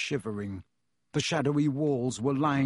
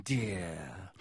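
lower, softer nasty mock pity; sneering, dropping low; 7 semitones lower, 8 dB quieter than usual. A narrator's reading. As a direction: let the second dear sink with contempt. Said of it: "smiling still more nastily".

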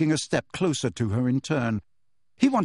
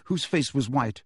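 usual speaking voice

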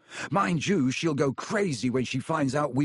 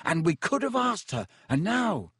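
bitter; 7 semitones higher than usual. A narrator's reading. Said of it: "said Ron bitterly".

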